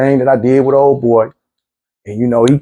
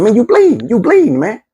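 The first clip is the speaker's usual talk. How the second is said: alarmed, pressing him to notice the blood; 8 semitones higher than usual. Retold or imitated. To the bloodied man he fought, urgent alarmed cry.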